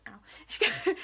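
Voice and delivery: ordinary talk, nothing marked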